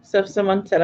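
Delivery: usual speaking voice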